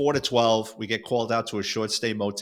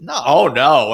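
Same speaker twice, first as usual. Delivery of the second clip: emphatic refusal; 3 semitones higher, 10 dB louder, slower than usual. Retold or imitated. Protesting to partner in retold story.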